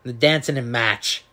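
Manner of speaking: mock Boston accent with MARCH flattened to "match"; copying someone's Boston accent; imitated Boston accent. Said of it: "Boston accent".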